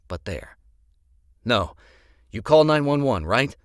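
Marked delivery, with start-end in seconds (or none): 1.4-3.6 loud, high urgent check; urgent question. Acted. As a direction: press her for an answer.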